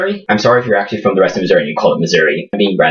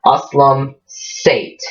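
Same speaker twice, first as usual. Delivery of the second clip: normal talking voice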